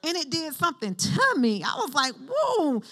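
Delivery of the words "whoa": high-pitched retold astonishment; high, awestruck exclamation; amazed whoa. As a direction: let it soar up in wonder. Retells amazed reaction to hearing God.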